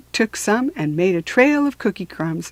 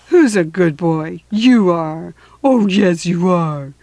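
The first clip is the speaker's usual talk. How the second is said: put-on baby talk to dog; 5 dB louder, slower than usual. Talking to a puppy.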